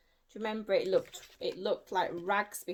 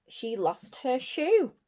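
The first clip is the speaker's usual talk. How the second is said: ordinary delivery, nothing marked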